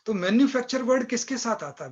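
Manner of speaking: normal talking voice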